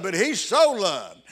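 "so" drawn out and leaned on, slow; stress on SO. Shown as a demonstration. Preacher stressing "so" to the congregation, slow and weighty. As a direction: hang the weight on "so". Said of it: "That "so" in there puts an emphasis on it".

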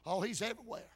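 ordinary talk, nothing marked

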